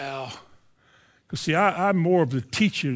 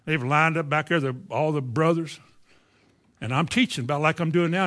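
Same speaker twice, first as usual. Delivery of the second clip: ordinary talk, nothing marked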